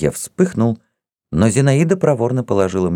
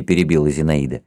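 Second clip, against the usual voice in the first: plain reading voice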